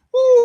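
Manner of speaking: high, loud joyful woo; a real happy whoop at a donation; excited whoop. Real, not acted. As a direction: let out a celebratory whoop. Cheering after a thank-you.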